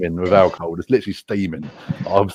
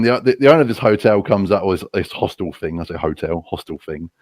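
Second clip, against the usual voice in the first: ordinary talk, nothing marked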